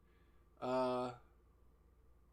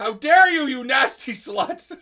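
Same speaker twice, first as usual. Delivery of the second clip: loud, high melodramatic fury, laughing; mock outrage; 10 semitones higher, 17 dB louder, much slower than usual. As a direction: ham up an offended soap-opera diva.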